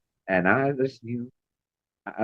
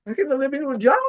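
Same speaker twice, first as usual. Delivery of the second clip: sing-song; 13 semitones higher than usual. Retold or imitated. High voice retold.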